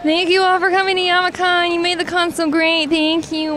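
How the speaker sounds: high, cartoonish put-on voice; a goofy high character voice; silly put-on voice. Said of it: "That might be worse than the Muppet voice".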